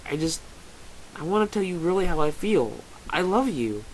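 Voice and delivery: tender, melodramatic outpouring; earnest lovestruck suitor. Acted. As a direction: stammer out a heartfelt confession. Rehearsed love confession in the story.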